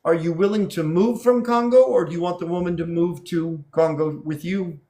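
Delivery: ordinary talk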